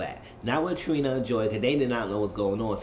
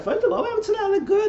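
mock outburst; 4 dB louder, faster than usual. High cry.

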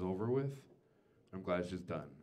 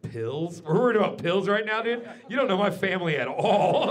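loud, higher exasperated yell at the vet; indignant shout; 9 semitones higher, 10 dB louder than usual. Acted. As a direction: bark it back in disbelief. Vet suggests pills, comic rant.

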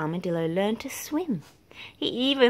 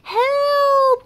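loud, very high panicked cry; cry for help; 10 dB louder than usual. A narrator's reading. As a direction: scream it as you fall. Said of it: "cried Armadillo".

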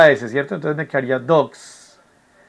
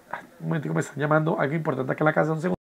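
quick, softer apology for interrupting; hushed aside; 8 dB quieter, much faster than usual. Real, not acted. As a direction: excuse yourself quietly, voice dropped. Steps away to take a call.

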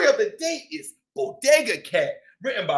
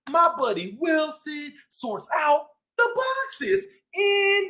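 lively shopkeeper cat telling his story; boastful bodega-boss cat, upbeat; cat narrator Chip; 3 semitones higher, slower than usual. Acted.

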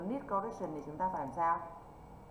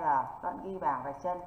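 usual speaking voice

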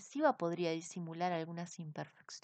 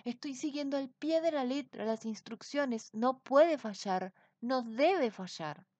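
higher, anxious insisting; nervous wizard; 5 semitones higher, 6 dB louder than usual. A narrator's reading. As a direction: reassure yourself it must work. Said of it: "decía asustado el brujo".

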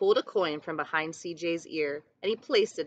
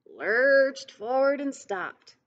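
slow and drawn out, mimicking the lurching bus; slow lurching repeat; 5 semitones higher, 3 dB louder, slower than usual. A narrator's reading. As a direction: let the bus lurch in the words. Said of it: "repeated line, acted slower".

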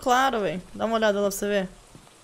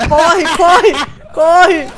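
loud, higher shouted urging; being chased; 5 semitones higher, 11 dB louder, much slower than usual. Real, not acted. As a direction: yell at them to run. Ghost hunting, urging run.

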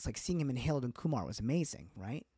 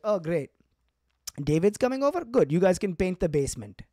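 higher, louder cheerful parents; parents' voice; 6 semitones higher, 8 dB louder than usual. Retold or imitated. Parents welcoming David to work, mock cheerful. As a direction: sound thrilled and hand out chores. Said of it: "Be like".

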